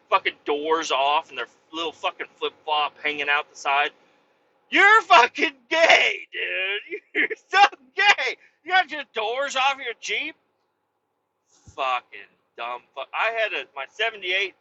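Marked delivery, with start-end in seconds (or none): 4.7-10.4 high, loud mocking jeer; high mocking bro taunt. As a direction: sneer it at the Jeep guys in a high put-on voice. Mocking Jeep guys in a voice.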